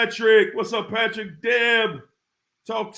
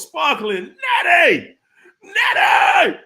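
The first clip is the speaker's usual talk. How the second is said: high, loud shout on the name; playful holler; 7 semitones higher, 7 dB louder, slower than usual. Real, not acted. Host spotting a favorite viewer, exuberant shout. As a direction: holler it out, stretching the last vowel.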